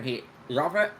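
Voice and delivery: usual speaking voice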